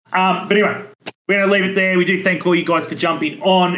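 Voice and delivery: upbeat host sign-off voice; podcast outro. Acted. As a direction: wrap up the show like a host.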